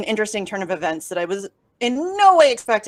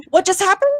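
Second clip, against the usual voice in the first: astonished repeat; 9 semitones higher than usual. Retold or imitated. Reliving the ruling with friends, higher astonished repeat. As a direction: push the repeat up high in shock. Stunned reaction to ruling news.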